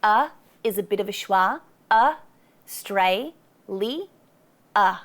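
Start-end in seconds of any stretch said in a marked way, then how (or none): 1.9-5.0 "A, stray, li, a" one syllable at a time; split syllables. Shown as a demonstration. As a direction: spell out each syllable of "Australia". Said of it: "four syllables".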